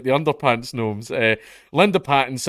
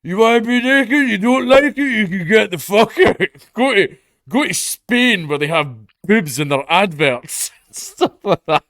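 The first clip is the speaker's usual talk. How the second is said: much higher and louder caricature of a grumpy villager, laughing through it; mock angry local; 9 semitones higher, 7 dB louder than usual. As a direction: ham up an outraged pensioner telling nudists to leave. Mocking angry local resident.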